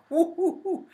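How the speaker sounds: light, high-pitched hoots; high mock "whoo". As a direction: hoot like someone gone loopy. Mimics someone gone off the deep end.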